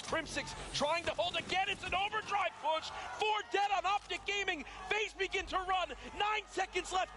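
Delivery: excited play-by-play. Real, not acted. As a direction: call the final push, climbing fast. Final seconds of close match.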